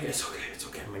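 whispered it's okay; whispered soothing; calming whisper. Retold or imitated. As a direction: hush him down gently. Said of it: "I'm like".

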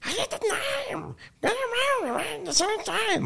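screeched way up in a strained falsetto. Retold or imitated. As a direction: mimic his piercing rock wail. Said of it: "that high with it".

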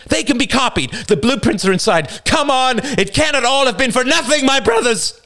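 high, urgent screamed plea; rally them at full pitch, frantic; desperate shouting. A narrator's reading. Bearded man pleading with his brothers, desperate shouts.

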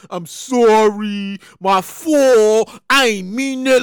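low, slow mumbled sorry; sarcastic imitation of a half-hearted sorry; mock apology. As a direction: drag out a lazy fake apology. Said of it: "he did the whole".

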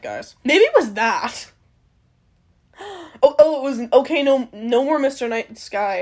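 normal talking voice

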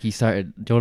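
usual speaking voice

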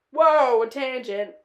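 loud, exclaimed whoa at herself; loud self-interruption. Real, not acted. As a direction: call yourself out loudly. Catches herself going off topic.

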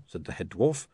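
ordinary delivery

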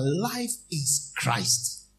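drawn-out, stressed life; slow refrain. Acted. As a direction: stretch it out like a chant. Refrain over music.

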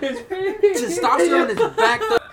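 loud, high laughing exclamation; slowly, cracking up while shouting it. Real, not acted. Laughing hype about players.

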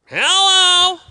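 high, loud nasal shout; nasal yell. Retold or imitated. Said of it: "in the most nasally voice, he'd yell out".

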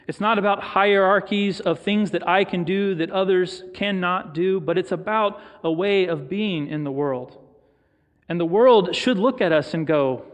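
ordinary talk

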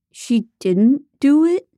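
hammed-up high, loud mock outrage; fake outrage. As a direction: play it badly faked, slow and too big. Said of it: "pretending to be outraged".

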